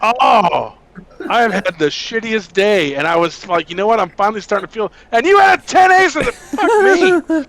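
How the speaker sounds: loud, high, exasperated outburst; angry rant. Real, not acted. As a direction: vent it like the worst day ever. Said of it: "Oh, I'm angry".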